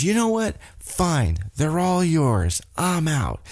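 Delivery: high-pitched mock storming off; imitated exasperation. Sammy walking out on his tag partner, mock exasperated. Retelling wrestler quitting on partner.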